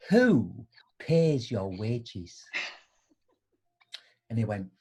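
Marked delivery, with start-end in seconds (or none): 0.1-2.5 slow pointed question. Retold or imitated.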